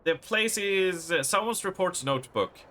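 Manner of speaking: ordinary delivery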